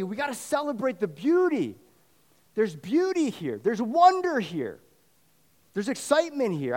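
rising joyful proclamation; celebratory preaching. Acted. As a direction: lift the crowd into wonder.

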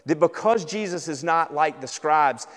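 normal talking voice

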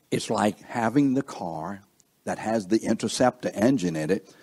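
normal talking voice

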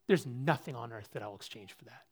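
usual speaking voice

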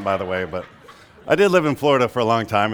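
ordinary delivery

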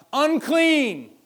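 loud leper's cry of unclean; shouted warning. Retold or imitated. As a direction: call out the warning across a distance. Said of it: "you have to shout".

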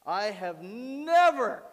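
slow, with "never" stretched out, high and loud; emphatic NEVER. Acted. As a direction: swell into a long, booming "never". Preacher dramatizes Moses' answer to God.

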